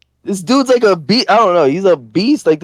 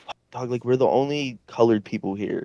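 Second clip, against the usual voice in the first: much softer, lower aside to a friend; hushed aside; 5 semitones lower, 10 dB quieter than usual. Retold or imitated. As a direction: say it under your breath, as if retelling a private warning. Confiding worriedly in friend during retold fight.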